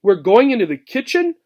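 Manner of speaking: high, stammering alarm; horrified. Acted. Gerbil Obie, horrified at going into the kitchen. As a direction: blurt it out in fright. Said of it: "She was horrified".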